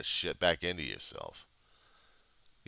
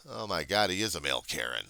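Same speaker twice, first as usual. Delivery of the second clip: usual speaking voice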